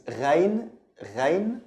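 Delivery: GH like a French R; French-R "ghain". Shown as a demonstration. Teacher introducing the next letter, French-R ghain. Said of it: "pronounced like the French R".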